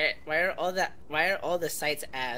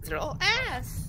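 much higher, slow goofy voice; silly high voice; slower than usual. Pretend. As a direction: stretch it out in a squeaky joke voice. Same words repeated in silly voice.

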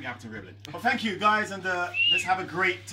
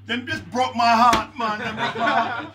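higher, louder, slow hammed-up lament; mock heartbroken wail; 8 semitones higher, 9 dB louder, slower than usual. Granddad in court wailing to the judge, mock heartbroken. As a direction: wail it like a wounded granddad, played for laughs.

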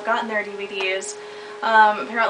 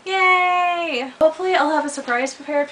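excited; 8 semitones higher than usual. Real, not acted. Announcing part three excitedly.

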